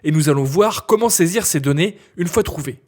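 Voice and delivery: normal talking voice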